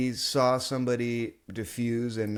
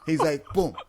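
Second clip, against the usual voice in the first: ordinary talk, nothing marked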